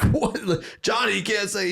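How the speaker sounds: high shocked protest. Retold or imitated. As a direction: gasp it out in disbelief. Gym guys protest.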